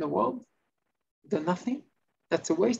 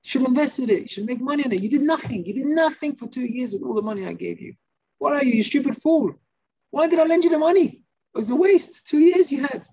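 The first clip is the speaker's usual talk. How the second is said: acted tirade, high and building louder; angry shouting; 7 semitones higher, 4 dB louder than usual. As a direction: scold him like an outraged lender, rising to a shout. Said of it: "the guy's screaming at him".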